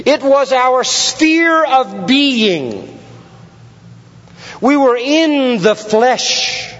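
high, forceful preacher delivery with drawn-out key words; impassioned, slow-building sermon emphasis; emphatic preaching. Real, not acted. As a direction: drive each phrase home from the pulpit.

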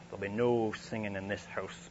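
stern Scottish lord's warning, voiced a bit apart from his own; Scottish accent. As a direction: imitate the laird's Scottish accent, stern and firm. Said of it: "with this sort of strong Scottish accent".

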